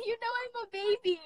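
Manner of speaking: laughing at herself while crying; real tearful laugh; laughing through tears. Real, not acted.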